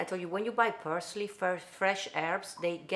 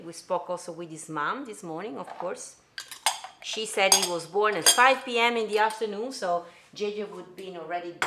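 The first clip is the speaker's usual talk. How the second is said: ordinary delivery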